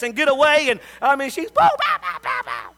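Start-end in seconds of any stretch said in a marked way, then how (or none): 1.5-2.7 imitated spanking swats. Imitating mama's flurry of swats.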